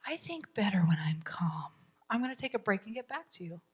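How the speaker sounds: soft, breathy, composed self-talk; calming self-talk. Shown as a demonstration. Parent excusing herself for a break when frustrated, calm and hushed. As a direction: say it quietly to settle yourself.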